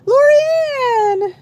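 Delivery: high, loud call of a friend's name; excited greeting. Real, not acted. Host spots an old friend in the chat, calling her name high and loud.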